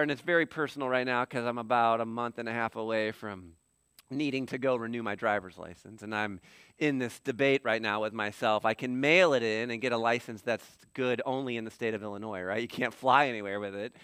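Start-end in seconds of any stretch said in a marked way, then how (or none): none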